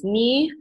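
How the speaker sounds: "ni" held high and level; first tone. Shown as a demonstration. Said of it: "it's, like, first tone".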